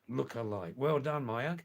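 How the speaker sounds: ordinary talk, nothing marked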